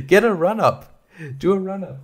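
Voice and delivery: coaxing the dog. Real, not acted. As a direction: egg the dog on.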